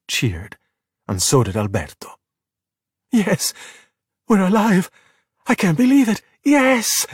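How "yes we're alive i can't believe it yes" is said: high elated shouting; roaring with joy. A narrator's reading. Luca after the splash, high elated cheering. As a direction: cheer at being alive. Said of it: "Luca roared".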